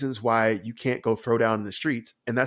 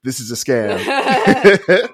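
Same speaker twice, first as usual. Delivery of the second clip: laughing refusal; 8 semitones higher, 10 dB louder, much slower than usual. Retold or imitated. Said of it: "you should be like".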